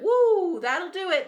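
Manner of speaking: high, loud alarmed exclamation; startled woo. Retold or imitated. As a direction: jolt back from a red flag. Seeing big red flags.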